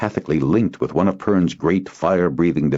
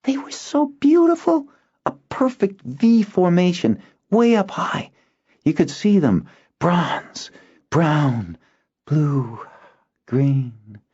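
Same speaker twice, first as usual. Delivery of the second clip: put-on awed boy fading; 14 semitones higher than usual. Boy recalling dragons, awed and fading. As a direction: drift into the memory. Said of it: "Zinar's voice faded".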